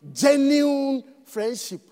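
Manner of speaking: genuine stretched slow and heavy, each syllable leaned on; stress on genuine. Shown as a demonstration. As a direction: draw out "genuine" slowly with weight before friendship. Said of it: "Emphasis on the word".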